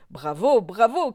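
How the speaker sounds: loud, swooping bravos; cheering cry. A narrator's reading. Mu applauding the bite, crying out loudly. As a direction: cheer it out in a storyteller's character voice. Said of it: "cria Mu".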